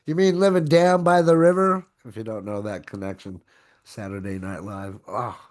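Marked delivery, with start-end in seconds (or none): 0.1-1.8 loud, high motivational-speaker shout; hammed-up Farley bellow; Chris Farley yell. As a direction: belt it out like Chris Farley's van-down-by-the-river rant. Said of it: "I wanted to yell out".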